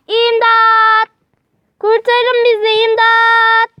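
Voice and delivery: desperate loud call for help; shouting for help. Acted. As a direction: yell for rescue from the bushes. Trapped kids shout for help.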